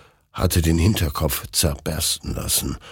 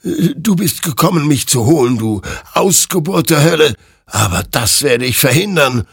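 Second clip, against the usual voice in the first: high, loud, agitated threat; threatening; 11 semitones higher, 6 dB louder than usual. Acted. Hector threatens the hellspawn with his rifle, frantic and loud. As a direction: threaten the foe at the top of your nerves. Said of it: "drohte Hector".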